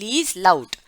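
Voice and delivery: Lies laut read as a loud order; loud command. Shown as a demonstration. Teacher reads the instruction like an order to children. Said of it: "थोड़ा जोर से पढ़ें".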